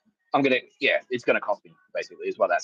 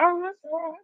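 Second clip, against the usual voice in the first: very high mock-defensive squeal; high-pitched, not aggressive, fending someone off; exaggerated high placating voice. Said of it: "a bit of defense up".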